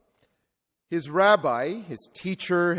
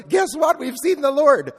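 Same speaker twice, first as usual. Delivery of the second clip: much higher and louder, bursting with joy; perky excited news; 9 semitones higher, 7 dB louder than usual. Retold or imitated. Disciple bursting in on grieving Thomas, excited. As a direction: blurt the good news like an eager disciple. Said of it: "some happy, perky disciple runs".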